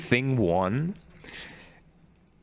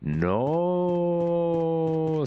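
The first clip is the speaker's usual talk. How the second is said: flat robotic no; 5 semitones higher than usual. Retold or imitated. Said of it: "just saying".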